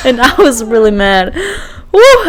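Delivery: ordinary talk, nothing marked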